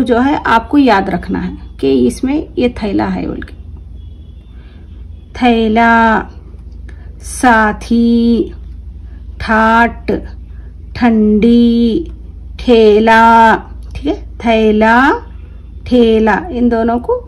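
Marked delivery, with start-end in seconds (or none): 5.3-16.4 each word drawn out slowly, clearly; careful drawn-out classroom reading; slow word-list reading. Shown as a demonstration. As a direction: read it slowly for children to repeat. Teacher reads word list aloud.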